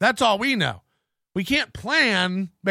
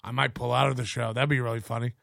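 mocking low voice; 10 semitones lower, 6 dB quieter, faster than usual. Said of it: "you decided to go".

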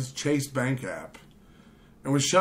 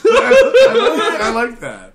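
laughing; 9 semitones higher, 12 dB louder, slower than usual. Real, not acted. Host cracks up.

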